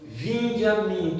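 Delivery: drawn-out, solemn plea; slow, solemn preacher's call. Acted. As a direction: stretch out each word of the divine invitation. Preacher quoting Jesus's call.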